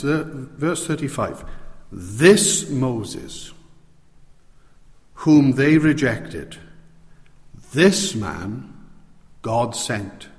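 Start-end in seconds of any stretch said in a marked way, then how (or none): 1.9-10.3 This hit hard, slow and deliberate; preacher stressing This Moses, this man; emphatic stress on This. Shown as a demonstration. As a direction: lean on each This with a pause after it. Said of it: "I can emphasize it like this".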